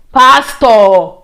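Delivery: high, loud baffled questions; excited puzzlement. Retold or imitated. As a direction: fire off the questions, bewildered. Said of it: "I said".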